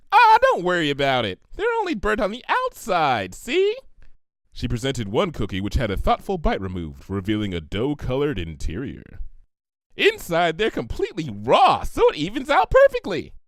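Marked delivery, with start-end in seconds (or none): 0.1-3.8 high, loud and breezy "Ah, don't worry about it"; carefree pony voice. A narrator's reading. Pinkie brushes off Twilight's burnt cookies, high and carefree. 10.0-13.3 high, bouncy and cheerful; bubbly pony voice. A narrator's reading. Pinkie gushes to Twilight about her cookies, high and bubbly.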